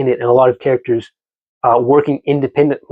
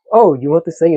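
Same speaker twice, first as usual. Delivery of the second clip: mock Russian woman; 5 semitones higher than usual. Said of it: "poor Russian accent aside".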